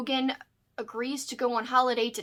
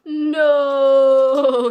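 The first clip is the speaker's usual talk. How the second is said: drawn-out, much louder wail of "no"; long loud no; 2 semitones higher, 14 dB louder than usual. Real, not acted. Owner stopping dog from chewing books, loud drawn-out wail. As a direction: wail it out in one long note.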